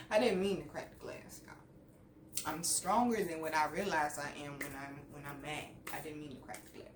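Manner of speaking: low, fading almost to nothing; very soft, guilty excuse; sheepish aside. Real, not acted. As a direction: murmur a small confession.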